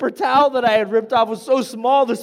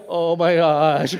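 drawn-out, low oh my gosh; mock shaky horror; 5 semitones lower, slower than usual. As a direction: slowly act out grossed-out dismay. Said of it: "I'm all shaky".

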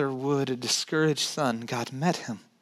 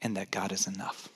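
a whisper, much softer and lower; 10 semitones lower, 11 dB quieter than usual. Real, not acted. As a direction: let it fall to a breathless whisper. Hushed sermon climax.

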